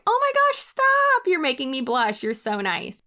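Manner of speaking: squealing high mock blush, then settling; fake flattered. Brushing off the commenter with a mock-flattered squeal. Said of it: "Just not reading it".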